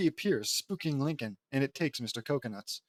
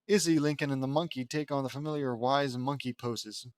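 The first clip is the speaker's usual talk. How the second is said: usual speaking voice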